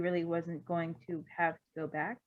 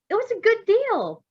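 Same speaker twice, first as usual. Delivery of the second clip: much higher, louder and slow, huffy; indignant defence; 13 semitones higher, 11 dB louder than usual. Acted. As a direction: insist on the bargain with wounded pride. Doctor frowning, defending purchase.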